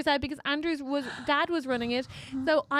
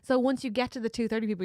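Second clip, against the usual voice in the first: ordinary talk, nothing marked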